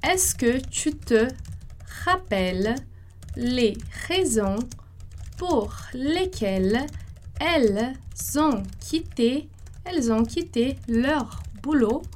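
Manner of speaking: slow, phrase by phrase; slow French model phrase. Shown as a demonstration. Teacher dictating the third phrase to students, chunk by chunk. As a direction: break it into small chunks with pauses. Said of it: "nossa terceira frase".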